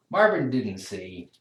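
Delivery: ordinary delivery, nothing marked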